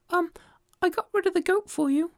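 very high, piping call; a tiny creature calling up; squeaky tiny voice; tiny ant voice. Acted. Ant reports success.

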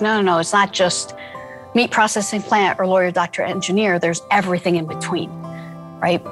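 ordinary talk, nothing marked

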